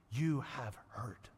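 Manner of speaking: slow, whispered; hushed. Acted. Preacher whispering the verse's meaning to listeners. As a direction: breathe the words out slowly. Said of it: "literally means in this verse".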